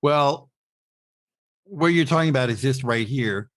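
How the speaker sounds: ordinary talk, nothing marked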